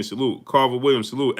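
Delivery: ordinary delivery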